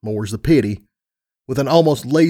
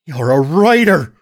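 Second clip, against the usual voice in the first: loud, raised-pitch accusation; angry accusation; 4 semitones higher, 5 dB louder than usual. Acted. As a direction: throw the accusation at a betrayer. Accusing brother in heated quarrel.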